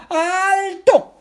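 very high, loud, drawn-out shout; hammed-up screamed command; emphatic warning. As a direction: yell a desperate halt, stretching the word. Resbalardilla leaps, stops her harder.

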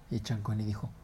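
usual speaking voice

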